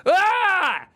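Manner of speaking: a sudden high, loud laughing sob; choked laugh-cry. Real, not acted. Streamer fighting tears while telling his chat the story, laughing sob. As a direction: laugh through tears breaking out. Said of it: "I'm not gonna cry".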